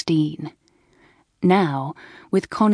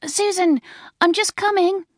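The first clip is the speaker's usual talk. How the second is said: put-on very high girlish call; excited shout; 13 semitones higher than usual. A narrator's reading. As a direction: call back eagerly to a friend. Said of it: "shouted Felicity".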